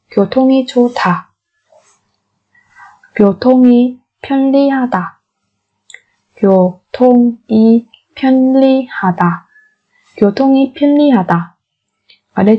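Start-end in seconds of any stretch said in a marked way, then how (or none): 0.1-1.3 교통이 좋다 said fast and smooth; quick native repeat. Shown as a demonstration. Teacher gives the fluent native-speed version, brisk. 10.2-11.6 교통이 편리하다 rattled off quickly; fast native repeat. Shown as a demonstration. Teacher gives the fluent native-speed version, brisk.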